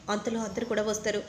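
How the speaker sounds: plain reading voice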